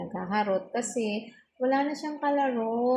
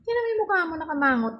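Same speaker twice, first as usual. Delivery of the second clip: high-pitched teasing at the dog; teasing pet talk; 7 semitones higher, 3 dB louder, much faster than usual. Real, not acted. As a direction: poke fun at the grumpy pup. Teasing the dog's grumpy face. (malambing na panunukso sa aso)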